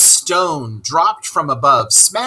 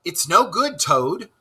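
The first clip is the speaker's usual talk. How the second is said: called out; 6 semitones higher than usual. Acted. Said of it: "called the rat after him".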